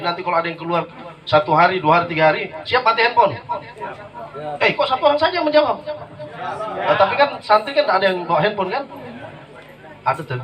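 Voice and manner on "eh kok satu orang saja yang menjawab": quick, high playful rebuke; teasing the audience. Real, not acted. Penceramah menegur jamaah. (menggoda jamaah dengan cepat dan tinggi)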